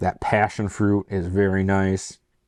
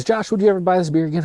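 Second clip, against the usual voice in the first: quick, higher-pitched mock viewer asking; mock viewer question; 9 semitones higher, much faster than usual. Reviewer voicing a viewer asking about rebuying, quick and high. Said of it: "Now the question is".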